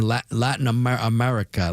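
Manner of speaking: Latin America in a plain American accent; American pronunciation. Shown as a demonstration. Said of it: "some people get mad when I use my ac".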